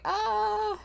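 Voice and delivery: long, high, soft "aah"; high "ah" reaction. Retold or imitated. Viewer reacting to one of those things, high "ah". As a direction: draw out a small high-pitched groan.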